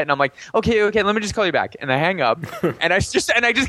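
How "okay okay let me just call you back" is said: fast, high-pitched mock placating; hurried placating. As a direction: imitate rushing her off the phone. Placating her to hang up.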